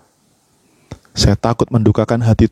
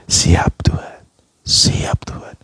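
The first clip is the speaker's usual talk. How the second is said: whispered surrender; 3 dB quieter, slower than usual. Retold or imitated. Preacher acting out his answer to God, submissive whisper. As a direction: breath-only whisper of obedience.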